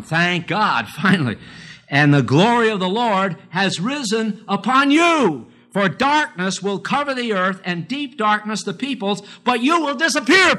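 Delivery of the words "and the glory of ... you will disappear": high, ringing preacher's reading, rising at the end; scripture reading. Acted. Preacher reading Isaiah to his congregation, high and ringing. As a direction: lift the verse up to the rafters.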